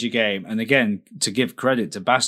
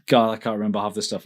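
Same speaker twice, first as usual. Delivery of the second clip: ordinary talk, nothing marked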